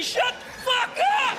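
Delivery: high, slow, raging yell; mock furious fan. Nashville fan kicking his TV, raging. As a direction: scream at the TV.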